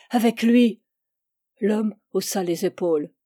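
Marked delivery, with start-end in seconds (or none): 0.1-0.8 louder desperate plea; child crying out against being left with him; frightened cry. A narrator's reading. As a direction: cry it out, terrified. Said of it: "Suppliante ... elle cria vers son père".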